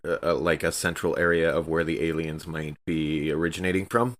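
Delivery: ordinary talk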